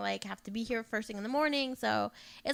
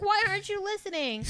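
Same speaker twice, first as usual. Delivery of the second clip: much higher, pleading and fed up; exasperated plea; 10 semitones higher, 3 dB louder than usual. Retold or imitated. Girlfriend to boyfriend who won't listen, high exasperated plea. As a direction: beg him to finally hear her. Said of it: "I was like".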